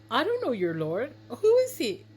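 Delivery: high, challenging king's voice; scornful Pharaoh. Acted. Pharaoh dismisses Moses's Lord, high indignant king. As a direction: rise to a high, loud challenge. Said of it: "But Pharaoh said".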